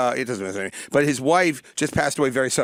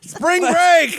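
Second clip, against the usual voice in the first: loud high party whoop; partying shout; 13 semitones higher, 5 dB louder than usual. Pretend. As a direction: cheer like a frat boy. Mock widower partying.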